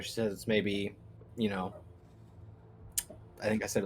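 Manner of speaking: usual speaking voice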